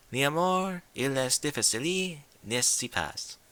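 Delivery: lightly French ladylike sigh; mock French. Rarity sighing over love in mild French. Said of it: "start using fancy language".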